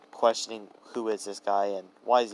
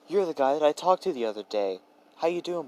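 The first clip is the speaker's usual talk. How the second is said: higher-pitched, cheery call-out; playful recognition; 6 semitones higher than usual. A narrator's reading. Deku pointing at Bakugo, playful recognition. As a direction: point him out brightly and high.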